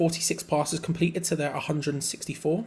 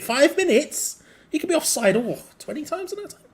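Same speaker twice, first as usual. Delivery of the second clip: mock disbelief; 12 semitones higher, 4 dB louder than usual. Real, not acted. Fan mocking the substitute's rare minutes, high and incredulous. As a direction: sound amazed he gets five whole minutes.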